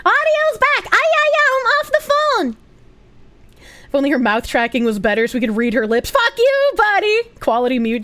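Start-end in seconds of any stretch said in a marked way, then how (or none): 0.1-2.6 squeaky high-pitched mock voice. High mock voice while muted. 6.1-7.2 squeaky playful insult; jokey high-pitched jab; high mock voice.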